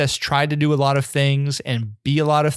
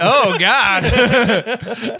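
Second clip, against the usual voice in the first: laughing; 8 semitones higher, 4 dB louder than usual. Retold or imitated. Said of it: "And you're like".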